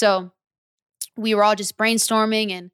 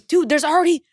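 higher and louder, breathless hype; freaking out; 9 semitones higher, 7 dB louder than usual. Retold or imitated. Telling his friend about the million views, hyped. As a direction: rave it like the numbers won't stop climbing.

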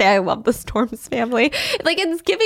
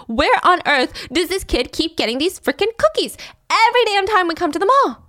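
exasperated, higher, laughing; puzzled family wondering aloud; mock bewildered parent; baffled parent; 8 semitones higher than usual. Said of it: "scratching their heads".

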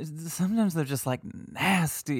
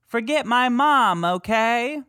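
much higher and louder ghost mimicry; mock EVP voice; 9 semitones higher, 6 dB louder, slower than usual. Host offering a guess to listeners, high mock ghost voice. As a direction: sing out the option like the recording. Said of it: "Is it A".

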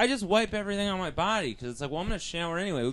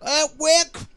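loud, slow, much higher mock Australian voice; bad Australian accent; 11 semitones higher, 8 dB louder, much slower than usual. Friend performs his bad Australian surfer voice. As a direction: ham up a terrible Australian surfer. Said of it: "Anthony voice".

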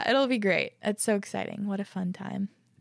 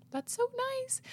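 touched; 5 dB quieter, slower than usual. Real, not acted. Reacting to family champagne tradition.

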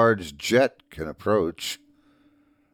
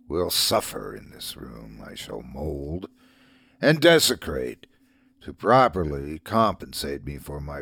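low, softer demon voice from a deep well; dark lord; 6 semitones lower, 4 dB quieter than usual. A narrator's reading. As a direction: speak as a shadow rising from the depths. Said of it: "the Dark Lord said".

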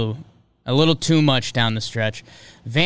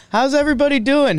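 much higher and fast; pumped-up host welcome; excited greeting; 11 semitones higher, 3 dB louder, faster than usual. Real, not acted. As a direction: bounce the hello out with hype. Host greets the audience again.

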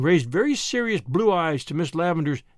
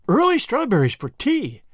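higher and louder, suddenly delighted; delighted exclamation; 6 semitones higher, 4 dB louder than usual. A narrator's reading. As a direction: perk right up at the thought of strawberries. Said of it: "exclaimed Miss Lavendar".

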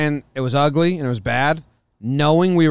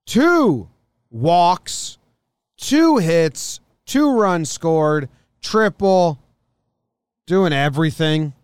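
excited; 3 semitones higher, 5 dB louder, much slower than usual. Real, not acted. Excited stat listing.

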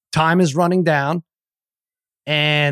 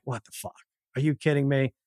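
retold under-the-breath grumble, much softer; muttered disbelief; 2 semitones lower, 8 dB quieter than usual. Muttered disbelief amid cheering gym.

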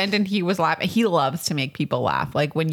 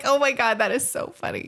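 amused exclaim; 7 semitones higher than usual. Retold or imitated. Retold delighted reaction.

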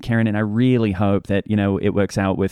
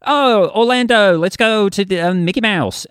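high, loud put-on Florida accent; 10 semitones higher, 5 dB louder, slower than usual. Shown as a demonstration. Australian guest demonstrating American accents, high loud Florida accent. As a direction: call it out slowly in a caricatured Florida accent. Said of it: "from Florida".

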